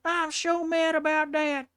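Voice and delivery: high, loud, slow whiny mock outrage; mocking an angry bigot. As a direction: parody a whiny bigot losing it over Warhammer. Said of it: "It's like".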